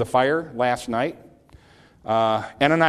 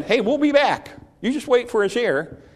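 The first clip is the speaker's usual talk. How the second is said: high, lifted call, louder at first; confident assurance; 11 semitones higher, 4 dB louder than usual. Retold or imitated. Abraham telling two servants to wait, high and confidently called. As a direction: toss it out brightly as a promise.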